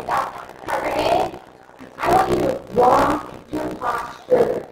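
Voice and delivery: loud workshop commands over the crowd; energetic seminar coach barking instructions; calling out to the room. Real, not acted. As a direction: project to everyone standing up. Coaching the room through an exercise.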